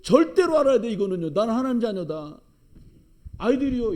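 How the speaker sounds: loud, high, full-force sermon voice; fiery preaching. Acted. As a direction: drive it home to the congregation.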